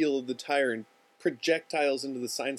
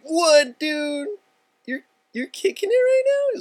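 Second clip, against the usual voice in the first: very high, loud, hammed-up amazement; over-excited disbelief; 10 dB louder, slower than usual. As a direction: gush at your buddy in a high, loud mock squeal. Buddy amazed on the phone.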